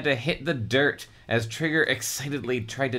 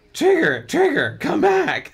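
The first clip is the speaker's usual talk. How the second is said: calling the dog much higher and louder; frantic calling; 6 dB louder than usual. Acted. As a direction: yell after him, desperate. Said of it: "calling frantically".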